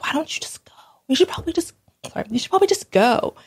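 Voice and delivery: high, hushed whisper of a pushy group member; mock hushed urging. Community group member whispering to her to just leave, hushed. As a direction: breathe it like a sly suggestion to leave.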